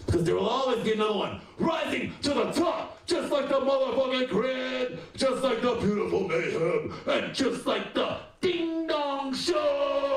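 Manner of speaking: building to a high shouted climax; lucha libre announcer. Acted. Building hype finale.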